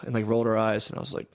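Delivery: ordinary talk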